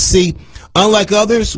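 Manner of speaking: ordinary talk, nothing marked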